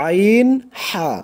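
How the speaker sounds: আইন, হা drawn out slowly from a squeezed mid-throat; Arabic ain and ha from the middle of the throat. Shown as a demonstration. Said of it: "গলা চিপে".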